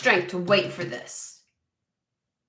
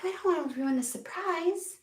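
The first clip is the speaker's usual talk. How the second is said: mock sweet; 8 semitones higher, 8 dB quieter than usual. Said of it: "they're just like".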